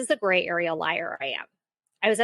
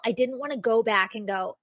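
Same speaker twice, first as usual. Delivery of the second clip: ordinary delivery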